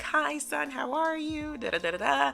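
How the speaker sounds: high-pitched mock mom voice, chirpy greeting; mom on the phone. Mother greeting her son over speakerphone, high imitating voice. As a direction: sing it up high like a doting mother.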